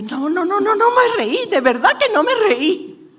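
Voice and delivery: negación alarmada, muy aguda y fuerte; Sara asustada. Acted. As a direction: imita a una mujer asustada que se defiende a gritos. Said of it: "Sara se asusta y dice". (negándolo con susto, voz muy aguda)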